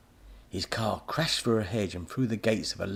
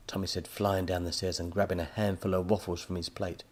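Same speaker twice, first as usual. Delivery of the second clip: ordinary delivery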